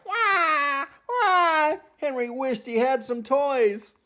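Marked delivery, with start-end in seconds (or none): 0.1-1.8 put-on high, wailing baby cry; baby crying wah. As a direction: wail like a fussy baby. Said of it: "Baby Amanda started to cry".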